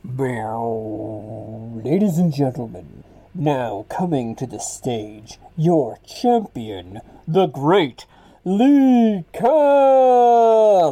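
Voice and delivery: low, slow intro building to a huge drawn-out name; hammed-up ringside introduction; arena announcer. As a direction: announce the champion, stretching every word. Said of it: "the lights go down and you hear".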